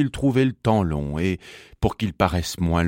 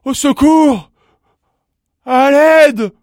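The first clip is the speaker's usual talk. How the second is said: high, loud, panicked yell; crying for help; 10 dB louder, slower than usual. A narrator's reading. Stuck bumblebee calling out for help, high panicked shouting. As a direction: yell for rescue at the top of your voice. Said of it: "il cria".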